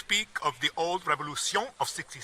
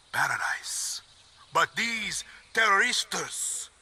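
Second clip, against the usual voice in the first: usual speaking voice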